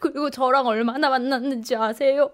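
drawn-out mock-weepy grievance; imitated tearful lament. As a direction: mimic a jilted person wailing a slow complaint. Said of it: "나한테 하소연처럼 막 하는 거야". (울먹이며 늘어지게 하소연하는 흉내)

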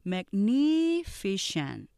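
magnificent with the second syllable stressed; stress on NIF. Shown as a demonstration. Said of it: "이렇게 발음하시면 정확하게".

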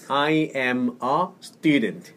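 very slow, separated words for repeating; teacher's careful slow model; slow model reading. Shown as a demonstration. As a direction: space out each English word for the learner. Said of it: "한번 따라해 보세요".

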